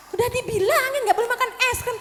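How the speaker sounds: high-pitched grumbling scolding; nagging. Acted. Parent giving in to a child over ice cream, nagging. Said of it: "Dikasih tapi sambil ngomel".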